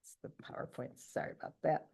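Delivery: quiet whispered aside, off mic; softly apologizing while fiddling with the screen; muttered aside. Real, not acted. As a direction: murmur it under your breath.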